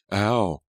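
sharp high "ow" at a stab of pain; sudden loud pained yelp; cry of pain. Acted. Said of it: "she cried out".

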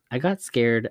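ordinary delivery, nothing marked

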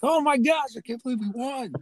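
very high imitation of an overjoyed friend; ecstatic disbelief. Teasing a friend's reaction to the final score, ecstatic disbelief. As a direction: squeal it in mock amazement.

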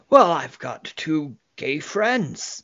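slow, put-on boast; imitating a man showing off; bragging. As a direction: announce it with drawn-out self-importance. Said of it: "it's something he's bragging about".

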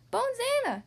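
delighted skeleton. Acted. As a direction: gush with joy.

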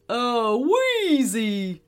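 slow, drawn-out teddy bear shout. Acted. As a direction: call it out gleefully as the teddy. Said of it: "shouted the teddy".

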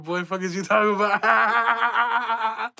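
high, slow words breaking into laughter; cracking up at his own slip; laughing. Real, not acted. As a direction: laugh through the question.

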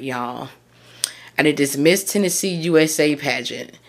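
ordinary talk, nothing marked

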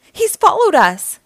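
high, loud warning; alarmed yell. A narrator's reading. April spots pursuing Dreg, alarmed yell. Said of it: "yelled April".

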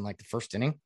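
usual speaking voice

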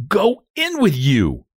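high, slow, drawn-out order; urging. A narrator's reading. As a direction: coax it firmly back in. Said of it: "urged Anne".

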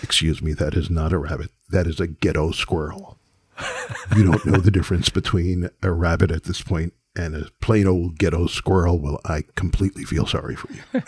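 condescending, low and soft put-down; Paul Mooney. Acted. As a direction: correct her with lofty, measured disdain. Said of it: "being as condescending as he could be".

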